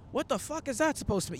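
high-pitched indignant reaction; offended outburst. Acted. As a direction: act out taking instant offense. Acts out offended knee-jerk reaction.

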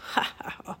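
short, soft, raspy cackle; raspy villain laugh. A narrator's reading. Raven King scoffs at the children, raspy laugh. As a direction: rasp out a gloating laugh. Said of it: "he laughed a raspy laugh".

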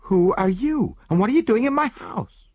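high, loud challenge to strangers; alarmed demand. Acted. Woman confronting strangers in her house, alarmed shout. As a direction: shout it out, startled and indignant.

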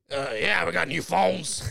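imitating a chain smoker's rasp; mock raspy New York coworker. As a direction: put on a very raspy salesman voice. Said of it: "He had the raspiest voice".

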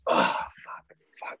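breathy whispered curse of sympathy; grunted sympathy. Shown as a demonstration. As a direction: exhale the words under your breath. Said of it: "just like".

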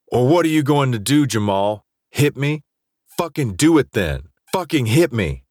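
high-pitched, heated goading; mild shout. A narrator's reading. Jace goads Jamal to hit him, shouting. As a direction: dare him, voice pitched well up, semi-shouted. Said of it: "I shouted at him".

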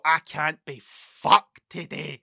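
lazy groan. Retold or imitated. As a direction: drag it out like you just woke up unwilling. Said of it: "get up at nine o'clock and go".

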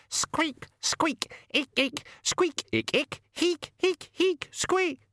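very high tiny squeaking; rat squeaks. A narrator's reading. The Death of Rats squeaking urgently at Susan, very high squeaks.

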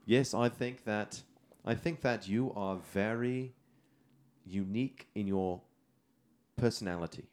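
slow, deliberate attempt at British; wobbly British accent, drawn out. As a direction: put on a careful British accent, pausing between phrases. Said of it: "kinda settled into a British".

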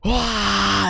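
big breath out with a high "wah"; demonstrated exhale. Acted. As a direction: model a calming breath for a child. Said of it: "And you go".

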